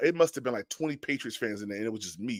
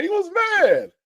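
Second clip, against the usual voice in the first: excited shout; 4 dB louder than usual. Real, not acted. Excited retelling, shout heard.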